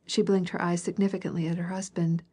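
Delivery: plain reading voice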